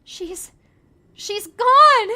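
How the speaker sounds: high, loud panicked cry. Acted. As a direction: shout it in rising panic. Panicked tourist.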